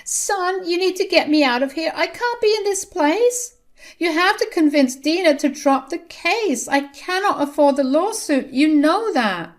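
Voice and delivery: a frantic mother begging her son; desperate pleading mother. A narrator's reading. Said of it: "Vivian still tried and said".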